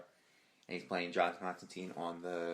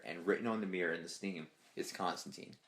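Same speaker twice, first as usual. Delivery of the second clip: ordinary delivery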